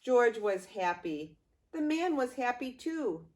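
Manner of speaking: usual speaking voice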